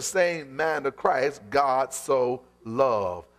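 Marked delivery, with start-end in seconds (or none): 1.5-3.2 slow emphatic. Shown as a demonstration. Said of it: "say it with me".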